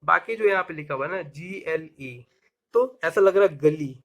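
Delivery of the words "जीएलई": softer, slow letter-by-letter spelling; spelled out slowly. Shown as a demonstration. Teacher spelling the word's ending, slowly letter by letter. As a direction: stretch out each letter.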